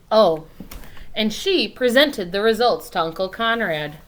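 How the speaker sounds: usual speaking voice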